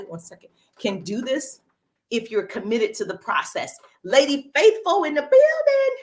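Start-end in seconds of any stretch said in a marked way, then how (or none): 4.0-6.0 loud, high-pitched hype announcement; excited shout-out. Real, not acted. Livestream host hails a viewer joining, excited shout-out. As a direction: hail a new arrival loudly. Hailing a viewer who joins.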